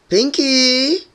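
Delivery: high, loud call up the stairs; calling shout. A narrator's reading. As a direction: call her name from downstairs. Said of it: "shouted a voice from downstairs".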